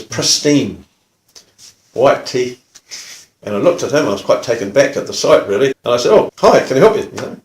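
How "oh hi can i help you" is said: higher, louder cheery hello; retold welcoming greeting; friendly greeting. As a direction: greet an unexpected visitor brightly. Greeting visitor at the door.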